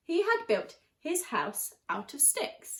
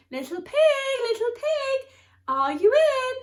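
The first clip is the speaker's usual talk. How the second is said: high sing-song call, much louder; 12 semitones higher, 7 dB louder than usual. Acted. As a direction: call sweetly up at the pig's door. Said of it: "so I called".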